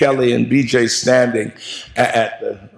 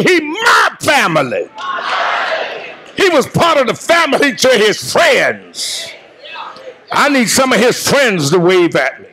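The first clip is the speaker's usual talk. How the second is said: full preaching; 7 semitones higher than usual. Acted. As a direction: preach it out at full force to the mourners. Eulogy preached to the congregation.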